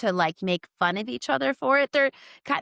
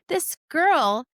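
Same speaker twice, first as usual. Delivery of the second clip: ordinary talk, nothing marked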